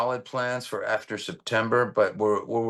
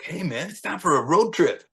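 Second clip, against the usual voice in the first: higher, lively mock fan voice; playful, pitched-up hey-man; retold excited invite; 7 semitones higher than usual. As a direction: imitate an eager buddy calling you out.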